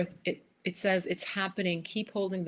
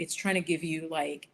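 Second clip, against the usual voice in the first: usual speaking voice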